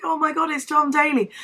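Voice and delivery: high, excited fangirl squeal; starstruck. Retold or imitated. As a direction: gasp it out starstruck. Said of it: "I was like".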